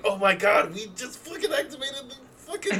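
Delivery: high, laughing panic; panicked yell. Acted. Hatchet Jones realizes the apocalypse has started, high panicked laughter.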